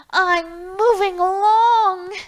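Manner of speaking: high, loud and slow, the toy boat calling out; shouted to the wind. Acted. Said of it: "it shouted to the wind".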